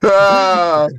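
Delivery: ordinary talk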